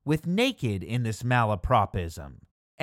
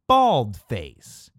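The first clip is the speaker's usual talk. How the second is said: bald face said firm and louder; stress on bald face; 3 semitones lower, 4 dB louder than usual. Shown as a demonstration. Teaching the right phrase, bald face stressed. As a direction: lean on bald as the right word.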